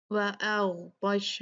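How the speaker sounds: slow "व अग़तश" with thick gh and t; "व अग़तश" recited with full-mouthed consonants; heavy letters. Shown as a demonstration. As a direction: darken "ग़" and "त" in "व अग़तश". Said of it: "इनको हम भारी पढ़ेंगे".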